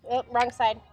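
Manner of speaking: normal talking voice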